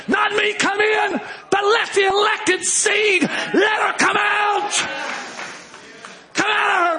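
high, drawn-out pulpit shout; impassioned high preacher's cry; full-force preaching. Real, not acted. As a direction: cry out the call, stretching each phrase.

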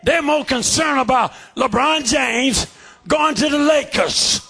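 shouted, driving sermon cadence; fiery preaching. Real, not acted. Preacher shouting his testimony to the church.

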